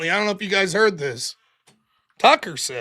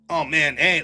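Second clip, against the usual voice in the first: usual speaking voice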